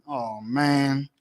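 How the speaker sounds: usual speaking voice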